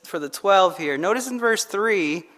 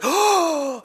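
excited gasp; 8 semitones higher than usual. Retold or imitated. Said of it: "I was so excited".

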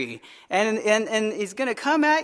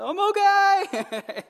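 high, slow, dazed little voice; dazed reply; 10 semitones higher, much slower than usual. Retold or imitated. Man on the floor telling his wife he's fine, high and slow. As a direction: squeak it out slowly, pretending you're fine. Stuck on floor after fall.

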